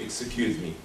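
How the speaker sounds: low, softened apology; bow out gently and respectfully; nice, polite way. Acted. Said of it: "but in a nice way".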